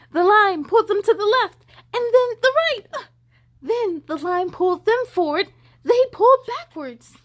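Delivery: high, loud, fast storytelling; excited narration. A narrator's reading. Narrator thrilling children with the tug-of-war, high and loud. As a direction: build the tension of the fight with the fish.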